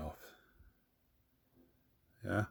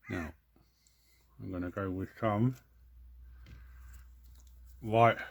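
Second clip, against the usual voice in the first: talking around a paintbrush in mouth; 2 semitones higher, 4 dB louder than usual. Real, not acted. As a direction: speak with a brush clenched in teeth. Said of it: "talking with a paintbrush in my ha-, mouth".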